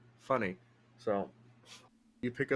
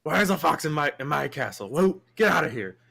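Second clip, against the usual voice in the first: indignant; 4 semitones higher, 6 dB louder than usual. Acted. NPC ordering fox out.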